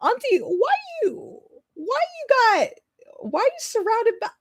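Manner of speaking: high whine of Auntie why you; mock whiny niece. Niece complaining to her aunt, high mock whine. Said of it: "being like".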